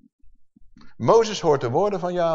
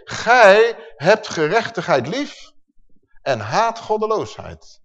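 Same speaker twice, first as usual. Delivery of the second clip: slow, emphatic psalm reading; solemn proclamation, opening high and loud; scripture declamation; 4 semitones higher, 4 dB louder, slower than usual. Acted. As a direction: proclaim the verse, drawing out the key words.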